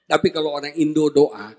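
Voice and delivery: normal talking voice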